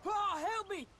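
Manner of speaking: put-on injured boy crying out to Paul; calling for help. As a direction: call out hurt and scared.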